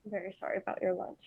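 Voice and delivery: soft, quick, mildly choked-up apology; understated choked-up sympathy. Acted. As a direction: apologise quietly with a touch of feeling. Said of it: "she seems choked up".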